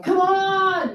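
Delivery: high, exasperated burst of come on; impatient come on. Retold or imitated. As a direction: push her, half-laughing impatience. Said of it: "And I'm like".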